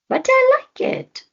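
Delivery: put-on very high, loud childlike insistence; Floki insisting. Floki insists to the panther, very high squeal.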